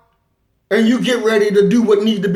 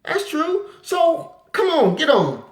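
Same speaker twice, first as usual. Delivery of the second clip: gullible frog; 6 semitones higher than usual. Retold or imitated. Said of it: "he's like".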